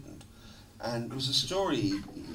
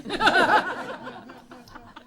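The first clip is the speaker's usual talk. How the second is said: high burst of laughter; 6 dB louder than usual. Real, not acted. Laughing at the memory.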